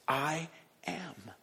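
a breathy hushed "I am"; whispered I am. Shown as a demonstration. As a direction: breathe "I am" almost silently. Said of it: "at the beginning of his phrase".

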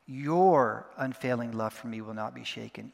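long, weighty "Your" opening the line; stress on YOUR. Shown as a demonstration. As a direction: hit the first word "Your" hard. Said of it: "taking it one word at a time".

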